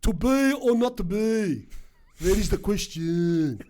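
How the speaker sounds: put-on loud, slow Shakespeare in a Greek-Australian accent; wog accent. Aspiring Shakespearean actor reciting Hamlet, hammed-up wog accent. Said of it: "with my wog accent".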